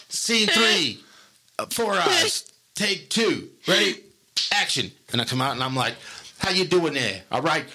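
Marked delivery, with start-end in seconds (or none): 0.1-4.9 high, raised slate call through a laugh; mock clapper announcement. Crew member slating take two of Four Eyes, high mock announcer voice. 6.4-7.6 bad New York accent, firing a man with swagger; terrible New York accent. Boss firing the main character, in a hammed-up New York accent. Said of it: "I did a terrible New York accent".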